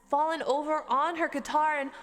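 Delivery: ordinary delivery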